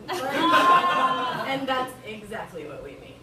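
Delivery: normal talking voice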